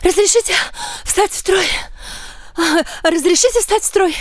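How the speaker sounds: put-on high childlike voice, eager; child voice. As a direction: ask like a small kid. Child asks to join line. (детский голос)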